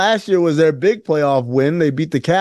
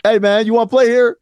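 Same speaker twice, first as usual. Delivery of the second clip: blunt reporter; 6 semitones higher, 3 dB louder than usual. Retold or imitated.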